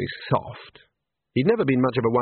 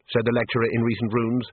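ordinary delivery, nothing marked

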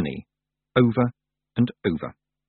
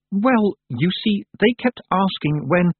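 hesitant; 10 semitones higher, slower than usual. A narrator's reading. Girl hesitantly confesses.

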